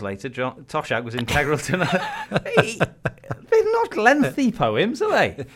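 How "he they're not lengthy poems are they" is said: slow, laughing question. Real, not acted. Asking his co-host while still laughing. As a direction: laugh through the words.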